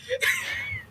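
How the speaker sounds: high squealing burst of laughter; a sudden high cackle at your own joke; shrieking laugh. Real, not acted.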